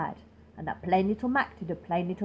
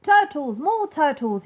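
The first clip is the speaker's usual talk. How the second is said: loud bellowed call; king calling turtles up from the pond; bellowing king; 4 semitones higher, 6 dB louder than usual. Acted. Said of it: "he bellowed and brayed".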